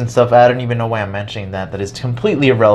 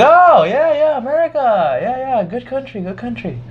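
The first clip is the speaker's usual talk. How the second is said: much higher, foreign-sounding put-on monk voice; Thai monk voice; 14 semitones higher than usual. A monk answers the student's "America," high and eager.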